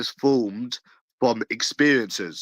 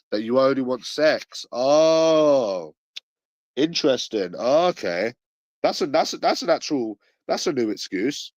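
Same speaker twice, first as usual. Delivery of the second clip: sarcastic mockery; 3 semitones higher than usual. Real, not acted. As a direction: feign fascination at a feeble excuse. Sarcastically mocking an excuse.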